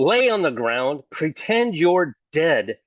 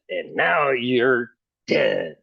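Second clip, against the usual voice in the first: slow spooky telling; much slower than usual. Shown as a demonstration. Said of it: "he loves it when I do that".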